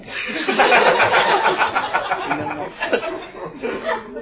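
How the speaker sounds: breathy high-pitched laughter; real laughter. Real, not acted. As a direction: burst out laughing. Laughs at teasing story.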